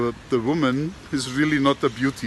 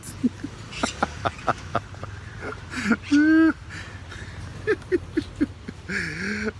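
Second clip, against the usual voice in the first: high, breathless laughter; real laughter he can't hold back; long giggling fit; 8 semitones higher, 5 dB quieter than usual. Real, not acted. Cracking up at the punchline.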